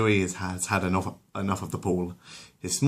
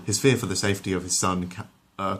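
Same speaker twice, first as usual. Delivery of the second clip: plain reading voice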